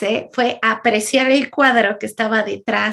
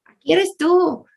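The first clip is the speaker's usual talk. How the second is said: higher-pitched imitation of a confronting therapist; 6 semitones higher than usual. Therapist confronting her client, imitated in a higher pitch. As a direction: mildly confront her, voice lifted. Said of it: "donde me confrontan".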